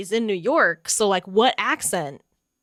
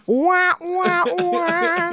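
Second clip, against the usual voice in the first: mock sound effect; 8 semitones higher, much slower than usual. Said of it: "doing his own fucking sound effects".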